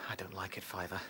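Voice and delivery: hushed, uneasy whisper; nervous whisper. Acted. Rabbit whispering his fear of the bridge to Fiver. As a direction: whisper it, afraid of being exposed.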